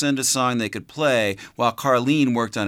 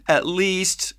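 put-on high, tearful child's plea; crying child; 7 semitones higher than usual. A narrator's reading. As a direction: beg through the tears. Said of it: "she cried".

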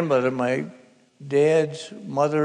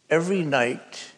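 ordinary talk, nothing marked

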